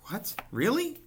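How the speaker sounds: very high, loud startled cry; astonished cry. A narrator's reading. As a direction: gasp it out in hope. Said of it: "cried Wilbur".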